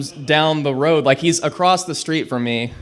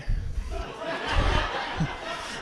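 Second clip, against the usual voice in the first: breathless laugh; 13 semitones higher, 10 dB quieter than usual. Real, not acted. Friend reveals cigarette mix-up.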